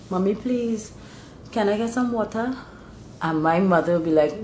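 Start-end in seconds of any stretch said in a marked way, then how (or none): 0.1-2.5 put-on higher, softer little child's request; young son. Son asking mommy for a drink, young child's voice. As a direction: ask softly like a small child. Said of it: "I remember my son, when he started to talk".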